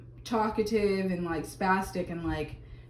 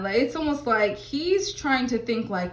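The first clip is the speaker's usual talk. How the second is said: ordinary delivery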